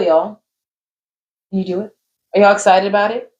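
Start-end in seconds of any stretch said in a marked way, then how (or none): none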